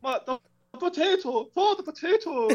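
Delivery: very high, hammed-up Irish lament; mock Irish accent. Farmer lamenting the potatoes, high mock Irish accent. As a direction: wail comically in a fake Irish accent.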